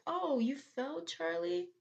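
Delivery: high-pitched teasing question; put-on high, rising reaction; mock concern. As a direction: coo at a fallen kid with fake sympathy. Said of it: "my reaction is gonna be like".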